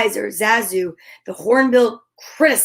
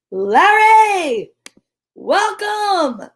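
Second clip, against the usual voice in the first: cheery welcome; 9 semitones higher, 4 dB louder, much slower than usual. Real, not acted. Greeting a guest on the show.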